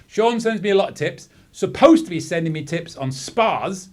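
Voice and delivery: normal talking voice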